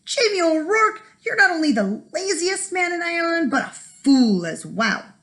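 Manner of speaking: high, scolding Irish wife's voice; furious wife. A narrator's reading. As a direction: scold him, fed up and furious. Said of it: "she was furious".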